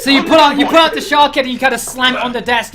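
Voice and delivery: loud, high, fast laughing narration. Real, not acted. Game master narrates the shark head, laughing.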